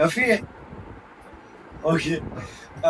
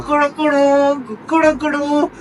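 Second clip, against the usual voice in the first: higher, slow stretched crowing; rooster crow; 9 semitones higher, slower than usual. Pretend. Made to crow again, slow and higher. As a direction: draw each crow out like a rooster. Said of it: "फिर दोबारा".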